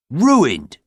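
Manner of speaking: loud, high, dramatic headline; headline cry. A narrator's reading. Reading out the headline in large type, loud and dramatic. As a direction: proclaim it like a banner headline. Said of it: "says the headline in large type".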